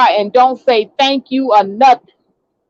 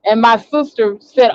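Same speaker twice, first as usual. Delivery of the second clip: usual speaking voice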